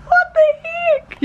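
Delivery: drawn-out high squeal; surprised. Real, not acted. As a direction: squeal it slowly in disbelief. Surprised arrival.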